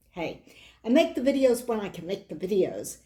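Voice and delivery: normal talking voice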